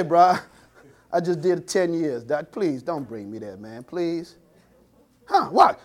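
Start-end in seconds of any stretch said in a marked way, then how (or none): 0.0-4.4 low, soft pleading please. Retold or imitated. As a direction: beg quietly, almost laughing. Retold inmate pleading softly.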